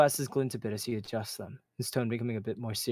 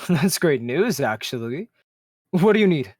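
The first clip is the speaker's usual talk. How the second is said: higher, louder, pleased voice; brightened; 5 semitones higher, 9 dB louder than usual. Acted. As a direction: perk up with delight. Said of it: "His expression brightens".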